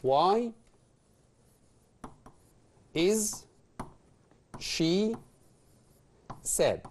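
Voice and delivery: slow, one word at a time with pauses. Shown as a demonstration. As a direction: write it on the board word by word. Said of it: "نشوف مع بعض كده".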